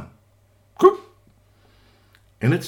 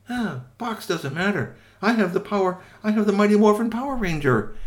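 higher, proud declaration; triumphant; 10 semitones higher, 4 dB louder than usual. Acted. Witch proud of her Power Ranger, higher and bold. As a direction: announce it with pride.

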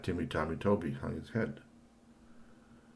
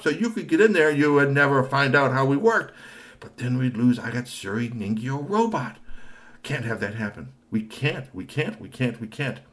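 high excited inventor; 12 semitones higher, 9 dB louder than usual. Acted. Robot maker explains high-voiced.

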